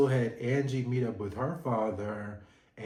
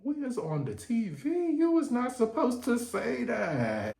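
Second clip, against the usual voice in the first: mock Bronwyn; 10 semitones higher than usual. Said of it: "Bronwyn was just sitting there looking like".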